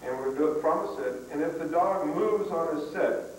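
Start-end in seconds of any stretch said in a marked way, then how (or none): none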